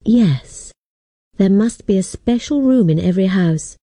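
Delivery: ordinary delivery, nothing marked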